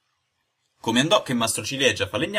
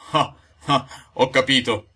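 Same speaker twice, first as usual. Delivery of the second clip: a mildly louder, forced laugh; 3 semitones higher, 5 dB louder, slower than usual. Acted. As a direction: force a nervous laugh to hide the fear. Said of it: "sforzandosi di ridere". (risata forzata e nervosa)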